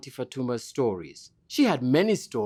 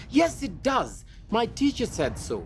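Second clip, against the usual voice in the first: high, insistent retort; angry girl; 8 semitones higher than usual. A narrator's reading. Girl snaps at her brother, raised and high. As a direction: stamp back at your brother. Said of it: "Zuri was angry".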